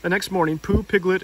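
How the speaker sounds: ordinary delivery, nothing marked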